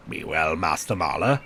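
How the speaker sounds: low monk's voice; deep, restrained goodbye; prior's farewell. Acted. Prior bids Andreas farewell in character.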